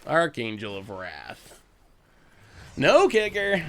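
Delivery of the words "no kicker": high, loud surprised "No kicker". Real, not acted. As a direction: caught off guard. Reacting to opponent's play.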